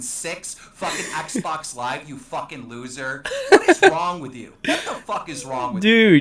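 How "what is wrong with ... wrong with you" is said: loud angry outburst; real hostile yelling at an opponent. Real, not acted. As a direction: shout it in exasperation, starting high. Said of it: "why you're so hostile".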